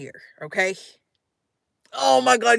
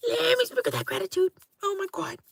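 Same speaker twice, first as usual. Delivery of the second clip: a much higher, quick, sing-song blessing; 7 semitones higher, 6 dB quieter, faster than usual. Pretend. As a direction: toss the gratitude out in a lifted put-on voice. Blessing viewers before bed.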